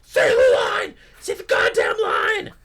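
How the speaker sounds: yelled, hammed-up director rant; mock furious shout. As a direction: bark it like a fed-up writer. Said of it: "He's like".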